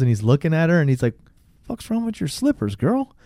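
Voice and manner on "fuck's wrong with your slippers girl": softer, high incredulous question; high-pitched bafflement. Retold or imitated. Man noticing the girl's slippers, high and baffled.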